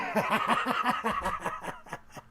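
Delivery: breathy unvoiced chuckle; laugh. Real, not acted. Host laughing at his own bank account joke, airy chuckle.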